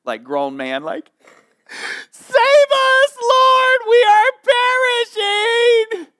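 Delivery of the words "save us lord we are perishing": high, loud terrified wail; hammed-up horror movie scream for help; girl cry scary movie. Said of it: "this is girl cry, scary movie, like, grown man".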